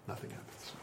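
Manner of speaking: breathy whispered echo. Shown as a demonstration. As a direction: whisper it back.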